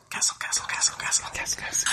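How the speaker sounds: fading whispered echo. Pretend. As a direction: whisper each repeat like an echo.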